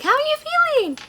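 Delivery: very high-pitched imitated check-in; chirpy staff voice. Staff checking on sedated patient, very high imitated voice. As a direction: mimic staff in a high singsong voice.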